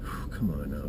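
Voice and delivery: breathy whisper to psych himself up; whispered pep talk. Real, not acted. Hunter whispering to himself before the hunt, hushed. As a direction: whisper a quiet come on to himself. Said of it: "Trying to get pumped up here".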